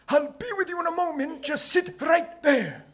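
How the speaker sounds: put-on high-pitched old man character voice; very high, put-on old shoemaker voice; old shoemaker, welcoming. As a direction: pipe up as an eccentric old shopkeeper.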